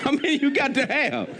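chuckling through the question; amused preacher laughing mid-question. Real, not acted. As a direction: laugh as you ask it.

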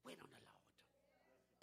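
slow, soft breathy whisper. Acted. Preacher whispering the lesson to the congregation. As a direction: hush it, let it sink in.

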